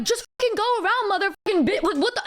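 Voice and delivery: retold cursing, worked up but not shouted; indignant mock outburst; angry retort. Her own retort, retold quarrel.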